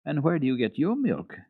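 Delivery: put-on curious girl. A narrator's reading. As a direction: Heidi asks the boy about milk. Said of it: "Heidi wanted to know".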